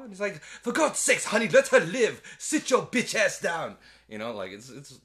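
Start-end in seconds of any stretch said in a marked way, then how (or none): 0.4-3.8 higher and louder, laughing through it; hammed-up fed-up father, cracking up; mock exasperated dad.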